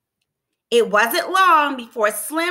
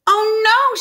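high, loud cry of dismay; alarmed exclamation; 9 semitones higher, 6 dB louder than usual. A narrator's reading. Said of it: "she exclaimed".

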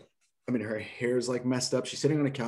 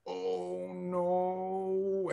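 dismayed; 8 semitones higher, 4 dB quieter than usual. Retold or imitated. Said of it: "I, like, said that out loud".

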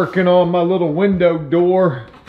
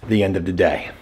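ordinary delivery

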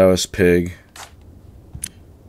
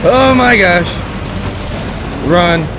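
panicking gamer; 8 semitones higher than usual. Real, not acted. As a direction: yell in rising panic. Chased by enemy, panicking.

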